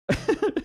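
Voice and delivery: high-pitched giggle; helpless little laugh at your own flop; self-mocking laugh. Real, not acted. Host botching his plug, high amused giggle.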